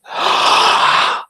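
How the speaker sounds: startled gasp. Retold or imitated. Acting out woman waking startled.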